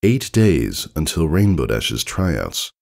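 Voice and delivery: ordinary delivery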